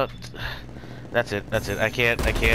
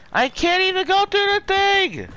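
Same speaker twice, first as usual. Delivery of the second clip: high, loud frustrated shout; frustrated yell; 4 dB louder than usual. Real, not acted. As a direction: yell at the lag. Raging at lag.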